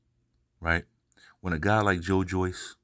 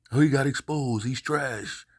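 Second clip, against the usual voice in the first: mocking quote; 8 semitones higher than usual. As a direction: sneer like a smug fan. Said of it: "the so-called boxing fans".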